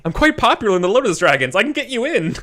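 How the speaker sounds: high, loud captive's plea; desperate bargaining. Acted. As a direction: blurt out an offer to save yourself. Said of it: "bargain with you, like, as soon as he starts getting hurt".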